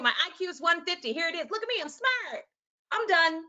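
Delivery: hammed-up show-off, high-pitched; mock smug boasting. Teacher mimicking someone who thinks IQ settles it, mock smug.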